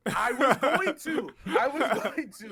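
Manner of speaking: sheepish laugh through the words. Real, not acted. Caught not mentioning a show, he laughs it out.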